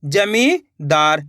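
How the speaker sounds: जमींदार stretched slowly and low; slow reading. Shown as a demonstration. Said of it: "या फिर इस तरीके से पढ़ लीजिए". (जमींदार को धीरे और नीचे स्वर में)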